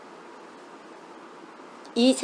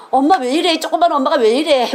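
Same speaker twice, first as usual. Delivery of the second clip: put-on higher-pitched kids' cry of dismay at mom; protesting children; 6 semitones higher, 4 dB louder than usual. Children see mom's bleeding hands, protesting. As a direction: imitate children fretting at their mother. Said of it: "우리 애들이".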